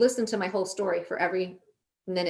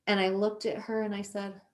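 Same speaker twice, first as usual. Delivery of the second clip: usual speaking voice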